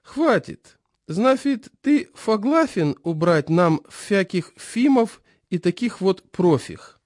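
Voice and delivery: put-on high, slow lisping old man; toothless old man. A narrator's reading. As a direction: mumble slowly through gums. Chairman voiced.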